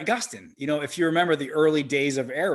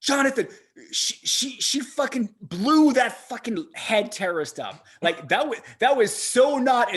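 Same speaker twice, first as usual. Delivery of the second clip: excited; 9 semitones higher, 5 dB louder than usual. Real, not acted. Excited outburst about movie scene.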